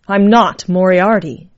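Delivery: loud, with NOT punched hard; emphatic denial. A narrator's reading. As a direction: insist firmly, leaning on "not". Dying woman insists to Death.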